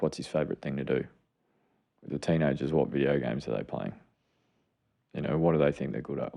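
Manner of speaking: ordinary talk, nothing marked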